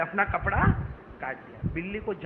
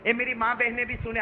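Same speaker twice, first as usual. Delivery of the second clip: ordinary talk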